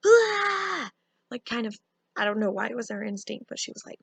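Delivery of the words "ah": a sudden high, loud yelp of fright; sister's short high scream; startled shriek. Retold or imitated. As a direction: shriek as the fruit hits. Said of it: "just goes".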